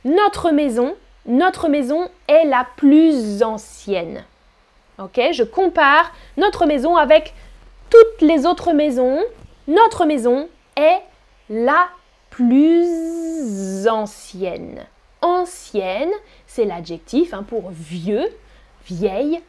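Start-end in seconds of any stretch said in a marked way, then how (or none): 2.8-4.2 "plus ancienne" linked as plu-zancienne; slow teacher's model of "plus ancienne" with Z; Z liaison. Shown as a demonstration. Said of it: "on fait la liaison". 12.3-14.9 "plus ancienne" hugely stretched, plu-zzzz-ancienne; very slow liaison demo, "plus" humming into "ancienne"; exaggerated Z liaison. Shown as a demonstration. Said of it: "on fait la liaison".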